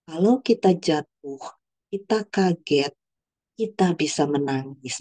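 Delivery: put-on slow, spaced-out phrases for a child; demonstrating slow validation to a crying child; patient parent. Shown as a demonstration. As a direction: explain it gently, phrase by phrase, slowly. Models parent explaining to crying child.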